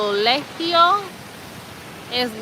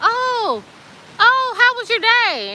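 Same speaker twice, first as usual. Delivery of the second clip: high, put-on friendly man voice; mock man with yellow hat; 9 semitones higher, slower than usual. The man with the yellow hat asks about the day, hammed-up high. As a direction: play the cheery man checking in.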